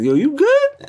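jokey high "you good?"; brushing it off as a joke; mock casual. Teen on the phone, joking, mock casual. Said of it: "I'm, like, joking".

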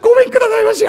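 high, loud blubbering goodbye; a man sobbing comically as he takes his leave; odd crying farewell. Acted. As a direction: wail it while leaving. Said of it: "変な泣き方して行っちゃった".

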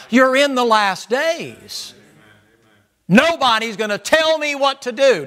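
loud, high, slow preaching cry; preaching shout. Acted. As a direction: call it out slow and loud over the congregation. Preaching at full force.

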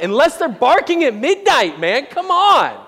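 exasperated outburst climbing high and loud; mock outrage. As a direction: build to a hammed-up, high-pitched protest. Comic outburst at barking dogs.

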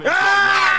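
high, loud sustained scream; long excited scream. Real, not acted. Gamer screaming as game time arrives, high and loud. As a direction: scream through the whole big play.

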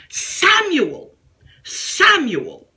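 God calling out. Acted. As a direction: call the name out high like God from heaven. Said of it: "calling you doubly by your name".

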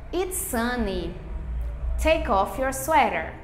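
slow, clear teacher voice reading examples; spaced-out classroom model sentences. Acted. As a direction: read each sentence out for learners to copy.

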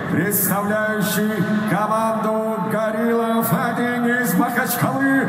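slow booming announcer call, rising; drawn-out name call. Real, not acted. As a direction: stretch every syllable for the crowd. Announcer calls fighter's name.

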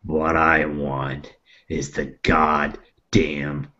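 low, very slow threatening voice; menacing demand. Acted. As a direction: draw it out low and deliberately menacing. Nog makes a slow menacing demand.

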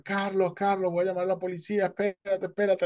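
high, urgent yelling; shouting from the hill. Retold or imitated. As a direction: call out to a drowning friend. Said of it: "empezó a gritar".

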